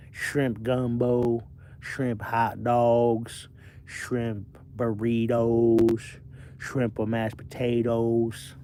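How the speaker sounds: very slow Southern drawl, words stretched out; slow, hammed-up Southern accent; Bubba. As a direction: list each dish in a lazy Southern drawl. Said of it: "kinda like Bubba Shrimp".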